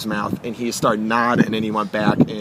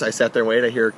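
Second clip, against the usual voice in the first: usual speaking voice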